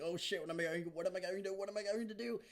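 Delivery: high, repetitive panicked babble; hammed-up rising panic without shouting; mock freak-out. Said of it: "crazy, and she's like".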